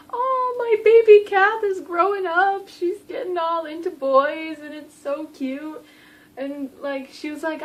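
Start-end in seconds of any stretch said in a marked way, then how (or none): 0.1-5.8 high, mock-tearful proud-parent gush; hammed-up fond wail. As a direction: coo loudly, then soften, high throughout. Gushing over character growing up.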